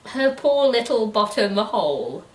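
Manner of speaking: ordinary talk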